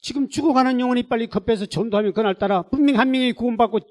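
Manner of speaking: high, loud pulpit rebuke; fierce reproach. Retold or imitated. God reproaching pastor about lavish funeral, fierce rebuke. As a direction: pour out the scolding at full force. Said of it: "엄청난 책망인데요".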